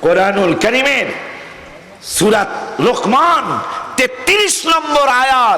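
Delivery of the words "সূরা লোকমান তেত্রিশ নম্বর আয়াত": slow, high proclamation; drawn-out preacher's shout; emphatic citation. Real, not acted. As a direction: announce the proof in a rising cry. Preacher proclaims his scriptural proof.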